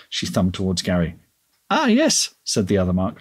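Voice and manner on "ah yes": cheery shop owner. A narrator's reading. Other Mark voiced in story.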